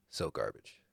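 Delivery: low hushed disgust. Real, not acted. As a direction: murmur it under your breath. Continuing the whispered verdicts.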